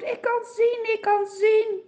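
high, jubilant cry; joyful shout. Acted. Healed man jumps and cries out, high and jubilant. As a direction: burst out with joy. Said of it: "springt in de lucht en hij roept".

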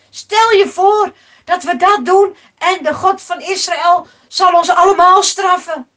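high, loud, emphatic objection; alarmed protest. Acted. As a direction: protest loudly, fearing punishment. (luid en geschrokken protesteren)

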